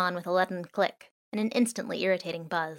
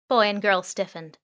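ordinary delivery, nothing marked

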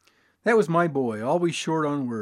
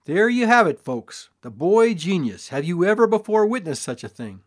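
loud, higher showman's boast; triumphant barker; 5 semitones higher, 7 dB louder than usual. A narrator's reading. Father boasts to the crowd, loud barker style. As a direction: crow over the result.

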